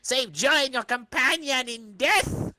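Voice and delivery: hammed-up comic-book villain; menacing villain. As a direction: threaten the intruders grandly. Villain threatens intruders.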